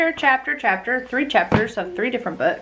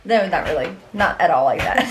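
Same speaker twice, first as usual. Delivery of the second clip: usual speaking voice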